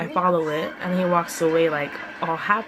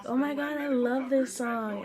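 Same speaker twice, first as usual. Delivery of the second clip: playfully extra; 6 semitones higher than usual. Retold or imitated. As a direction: gush over it, stretching the words out. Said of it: "I'm, like, so extra".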